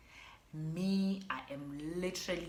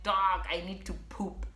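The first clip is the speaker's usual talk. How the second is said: urgent plea; 4 semitones higher, 6 dB louder than usual. Retold or imitated. Retold urgent plea in labor.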